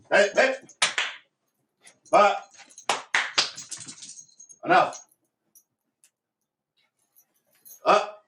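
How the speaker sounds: sharp, high, loud commands to the dog; high, loud, sharp voice to make the puppy back off. Shown as a demonstration. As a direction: snap it out to stop the dog. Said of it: "a sharp voice will calm your dog down".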